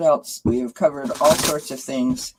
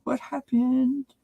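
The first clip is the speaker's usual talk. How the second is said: playful high-pitched uh-oh, climbing higher; mock concerned viewers; 11 semitones higher, slower than usual. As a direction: imitate puzzled viewers, stretching the last question high. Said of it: "everybody would be like".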